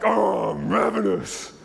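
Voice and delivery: loud, drawn-out hungry groan of a line; hungry Esau. Acted. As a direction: groan it out slowly, starving and hammed up. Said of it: "he comes and he's like".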